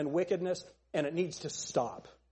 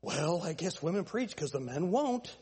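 mock-timid, hesitant answer; softened, spineless mimicry; wimpy; 4 dB quieter than usual. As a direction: give a feeble, put-on wimp's reply. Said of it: "why do we answer like wimps".

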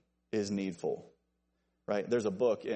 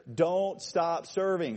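slow, higher "don't stop serving"; emphatic clarification; 6 semitones higher, 4 dB louder, slower than usual. Shown as a demonstration. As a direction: spell out "don't stop serving" so nobody misses it. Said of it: "in case it wasn't clear".

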